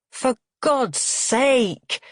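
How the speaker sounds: drawn-out, high exasperation; voice rising. A narrator's reading. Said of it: "Frank's voice rises again".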